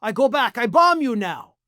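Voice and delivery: hammed-up angry Putin threat; mock Russian accent. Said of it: "And Putin says".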